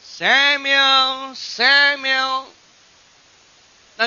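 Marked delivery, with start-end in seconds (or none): 0.0-2.5 raised, drawn-out call of a name; God calling, voice raised and sustained. Acted. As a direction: call the boy from afar, high and loud. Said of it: "The Lord called, came and stood and called".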